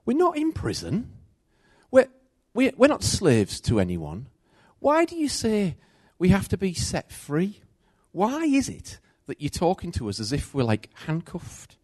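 high, protesting voice of the crowd; the crowd objecting to Jesus in a high indignant voice. Retold or imitated.

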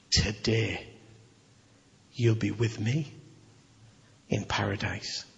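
low, soft and slow; Jesus promising the thief paradise, tenderly; tender promise. Acted. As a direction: promise it gently, with pauses.

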